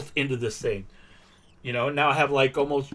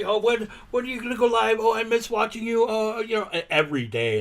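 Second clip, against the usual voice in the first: higher-pitched, quickened imitation of a fan; gushing young fan; 7 semitones higher than usual. Young viewer pestering a streamer to go live, mock gushing. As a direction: mimic an eager fan, pitched up.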